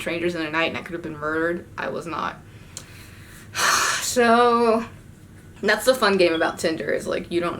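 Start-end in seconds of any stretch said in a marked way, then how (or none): 3.5-4.0 heavy exhale; audible sigh before going on. Real, not acted. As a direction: let out a big breath. Sighs before resuming story.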